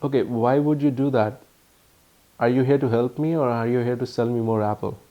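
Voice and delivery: usual speaking voice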